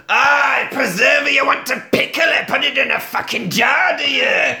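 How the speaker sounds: high-pitched hammed-up shouting; mock outrage. Jack misunderstands preserving Nessie, acted angry outburst. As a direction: rail indignantly, pitched right up.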